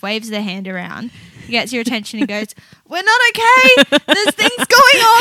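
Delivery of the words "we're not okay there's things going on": high, loud, drawn-out alarm; hammed-up office emergency cry; mock panic. Imagined worker waving in panic.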